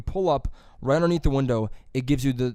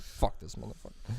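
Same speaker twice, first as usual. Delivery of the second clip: whispered mock curse under the breath; mock grumble; 4 semitones lower, 11 dB quieter than usual. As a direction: mutter it like a fed-up worker in the back. Guy in back ripping croissants.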